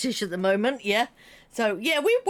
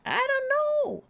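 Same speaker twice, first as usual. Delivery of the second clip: high, slow sing-song I don't know; playful high singsong; slower than usual. Acted.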